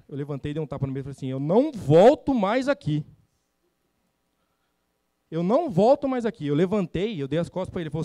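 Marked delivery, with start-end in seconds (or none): 1.3-3.0 firme e alto, devagar, ao bater na mesa; revolta encenada. Retold or imitated. Bate na mesa e fala. 5.3-6.5 repetido firme e alto, mais agudo; revolta encenada. Retold or imitated. Repete a frase indignado.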